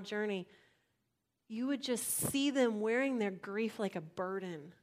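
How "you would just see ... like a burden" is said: ordinary talk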